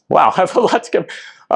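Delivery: a rueful laugh at the clock; startled little laugh, then carry on; laughing surprise. Real, not acted. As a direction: laugh it off, caught short on time. Surprised at time left.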